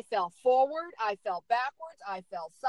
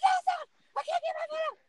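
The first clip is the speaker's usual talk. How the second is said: much higher, squeaky mock panic; panicked squeal; much faster than usual. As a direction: squeal it like you are stuck and scared. Answering rescuers while stuck in snow.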